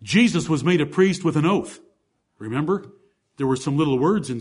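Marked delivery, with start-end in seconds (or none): none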